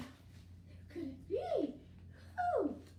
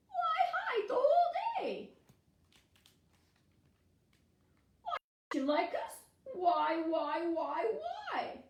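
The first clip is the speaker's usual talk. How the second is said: pleading; 5 semitones higher, 4 dB louder than usual. Acted. Pleading with hidden animal.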